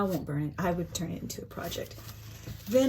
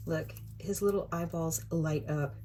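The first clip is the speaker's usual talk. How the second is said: ordinary delivery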